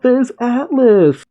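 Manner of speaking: high, drawn-out mock shout; crowd's cry. People spotting their champion arriving, high crowd cry. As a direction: call the name out high and long. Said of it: "as the people cry out".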